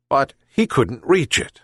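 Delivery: plain reading voice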